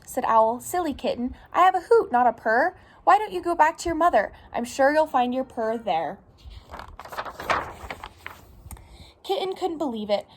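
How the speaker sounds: animated picture-book reading, lilting pitch; storytime read-aloud. Acted. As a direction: read it to little children, lively and clear. Reads picture book to children.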